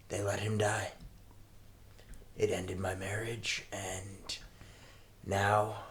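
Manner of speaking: ordinary talk, nothing marked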